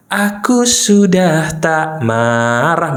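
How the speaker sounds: exaggeratedly drawn-out claim; mock declaration. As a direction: drag each word out heavily. Mimicking the wrong self-statement.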